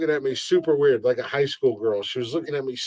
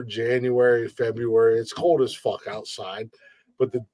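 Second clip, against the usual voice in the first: ordinary talk, nothing marked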